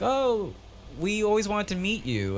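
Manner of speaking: put-on high-pitched kid voice; little children. Dream kids greeting him, voiced as little children. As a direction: put on a small child's wistful coo. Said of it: "these kids are like".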